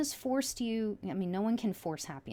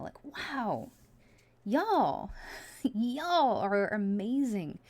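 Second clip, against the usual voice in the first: moved, louder, with a laugh and slow; real emotion; 3 semitones higher, 4 dB louder, much slower than usual. Real, not acted. Reader moved by the cards, telling viewers they're amazing, emotional. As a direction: overcome with feeling, laughing through it. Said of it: "I'm getting really emotional right now".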